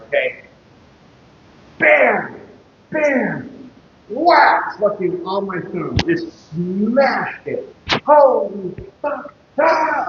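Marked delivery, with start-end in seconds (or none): 1.8-4.8 high, punchy mock hammer blows; hammer sound effects. 8.0-10.1 pained yell. Retold or imitated. Reliving smashing his thumb.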